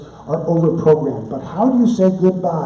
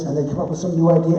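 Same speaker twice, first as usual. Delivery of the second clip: normal talking voice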